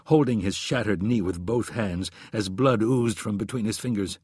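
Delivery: plain reading voice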